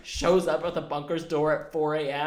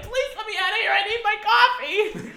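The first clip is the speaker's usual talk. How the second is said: much higher, laughing begging; desperate plea. Retold or imitated. Begging the ex to let her out, high whiny plea. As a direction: whine for coffee like you'll die without it. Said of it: "I was like".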